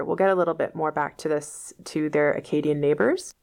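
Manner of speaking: usual speaking voice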